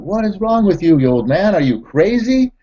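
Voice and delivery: put-on high-pitched yell at the old man; retold scream. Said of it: "screams at the old man".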